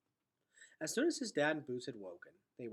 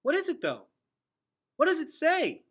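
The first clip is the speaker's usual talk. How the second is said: loud, much higher shout; 15 dB louder than usual. Acted. Gregor shouting to Vicus while flying off, loud and high. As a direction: yell after them as you rise. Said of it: "shouted Gregor".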